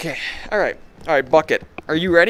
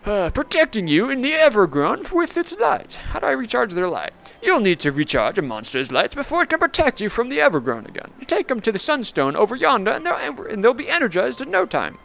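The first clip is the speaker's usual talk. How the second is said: much higher hammed-up old timer; folksy NPC voice; 10 semitones higher than usual. Clarence explaining the Sunstone to the player, high folksy voice.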